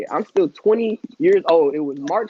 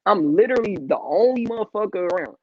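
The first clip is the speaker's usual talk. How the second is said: usual speaking voice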